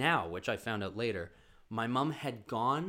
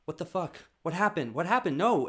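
alarmed mom; 5 semitones higher, 5 dB louder than usual. Retold or imitated.

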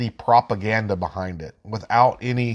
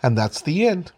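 put-on higher, lively reading for little kids; reading to children; 5 semitones higher than usual. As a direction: read it out like to small children. Reads infographic to kids.

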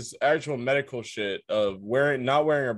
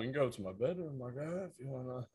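lightly voiced hushed come-on from a stranger; soft sleazy offer to come to the bedroom; sleazy invitation; 4 semitones higher, 13 dB quieter than usual. S1 voicing the guy's offer.

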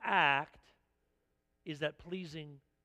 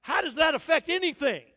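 louder, higher mock protest; mock indignant; 6 semitones higher, 8 dB louder than usual. Parent objecting to the grandparent, loud mock exasperation. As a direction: push back, exasperated.

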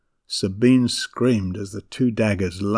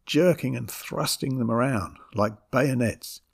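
ordinary delivery

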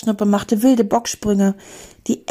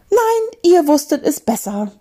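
high, indignant scolding; shrill-free high reproach; 7 semitones higher, 3 dB louder than usual. A narrator's reading. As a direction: tell them off sharply, voice jumping up. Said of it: "schimpfte Mathilda".

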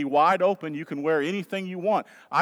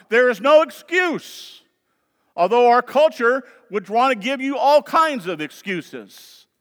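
loud, raised, emphatic sermon delivery; impassioned preaching; 4 semitones higher, 7 dB louder than usual. Acted. Pastor urging the congregation to flee temptation, impassioned preaching. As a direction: drive the warning home to the congregation.

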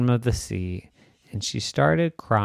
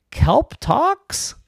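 high surprised squeak; lightly voiced startled eel; amazed eel; 13 semitones higher than usual. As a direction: squeak it in disbelief.

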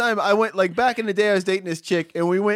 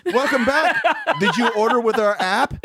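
higher, mock-chirpy automated greeting; drive-thru recording; 6 semitones higher than usual. Recorded drive-thru voice greeting a customer, mockingly imitated. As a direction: imitate the canned greeting through amusement. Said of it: "It's a recording".

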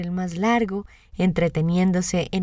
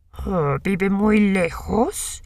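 lobo astuto, muy lento; voz de lobo; much slower than usual. A narrator's reading. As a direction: alarga cada palabra, lobo taimado. (voz de lobo lenta)

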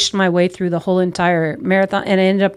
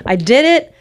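triumphant cry; 4 semitones higher, slower than usual. Retold or imitated. Reaching the waterfall triumphant.

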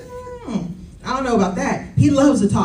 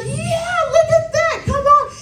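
high-pitched cheering over a new sprout; excited cheer. Retold or imitated. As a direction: cheer her on in a squeal. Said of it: "We were both excited. Like".

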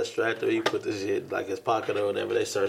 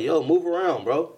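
higher, mildly raised retold yell; 7 semitones higher, 4 dB louder than usual. Student yelling back at whoever is knocking, retold yell. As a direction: call it out as if through a door. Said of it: "So I yell back".

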